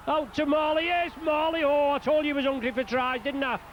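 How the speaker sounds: high-pitched, running excitement; excited try call. Real, not acted. Calling a try as it happens.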